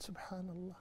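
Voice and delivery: breathy whispered awe. Retold or imitated. Man stunned by laughter at a burial, whispering. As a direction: whisper it in shock. Retold reaction at the graveyard.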